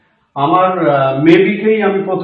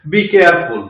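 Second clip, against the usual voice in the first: normal talking voice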